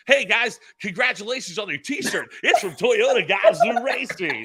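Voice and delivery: mock-enthusiastic plug; cheesy announcer. Announcer hamming up a sponsor plug for the crowd, loud cheesy read. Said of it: "my smart ass goes".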